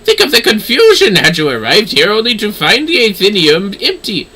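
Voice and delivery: crisp, proud noblewoman reading her lines; haughty young lady. Acted. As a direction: speak down to them like a proud scholar's granddaughter. Voicing the young lady character.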